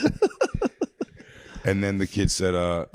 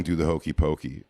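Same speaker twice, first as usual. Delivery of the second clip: ordinary talk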